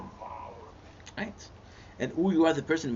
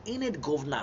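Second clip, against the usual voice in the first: mock British accent. Joking to the viewer in a mock British accent. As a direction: put on a Cockney-ish British accent for a laugh. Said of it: "I'm a British guy".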